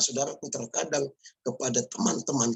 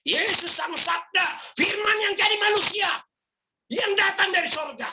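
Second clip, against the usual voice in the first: fiery preaching; 3 dB louder than usual. Acted. Preaching climax.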